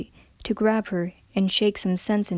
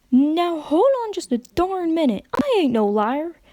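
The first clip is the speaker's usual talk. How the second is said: higher, indignant country protest; indignant outburst; 9 semitones higher, 3 dB louder than usual. A narrator's reading. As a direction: snap back, stung by the accusation. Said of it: "her voice much louder than she had meant it".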